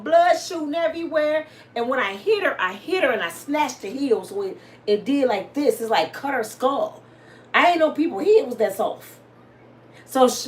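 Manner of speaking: usual speaking voice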